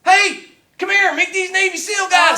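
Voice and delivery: loud, high director's holler; calling out. Retold or imitated. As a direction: imitate the director summoning people across set.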